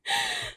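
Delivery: a sudden high laugh; high burst of laughter. Real, not acted. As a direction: laugh out sharply. Laugh after sad retelling.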